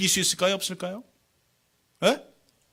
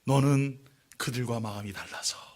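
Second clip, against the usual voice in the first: voice of God; 6 semitones lower, 4 dB quieter, slower than usual. Acted. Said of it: "하나님 뭐라고 말씀하시는지".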